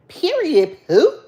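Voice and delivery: sassy high loud "Period, poo"; cousin's catchphrase. Retold or imitated. Mimicking her cousin's sassy catchphrase, loud and high. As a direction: snap out "Period" with attitude. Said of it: "in my cousin voice".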